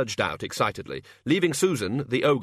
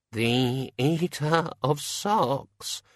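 very slow, raised-pitch moan of dread; 4 semitones higher, much slower than usual. A narrator's reading. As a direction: draw every word out in dread. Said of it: "moaned the senior wrangler with his eyes shut".

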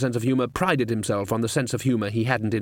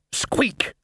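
high squeak; 12 semitones higher than usual. Acted. Rat squeaks.

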